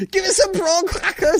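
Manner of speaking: high, hammed-up Liverpool demand for "prawn crackers"; mock Scouse. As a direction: Craig Charles asking for crackers, way up high. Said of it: "That's the hardest R ever".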